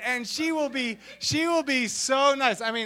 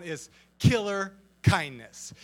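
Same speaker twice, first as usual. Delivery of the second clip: ordinary delivery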